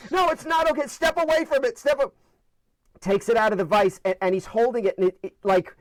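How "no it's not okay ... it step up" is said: freaking-out dad. Retold or imitated. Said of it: "He was freaking out".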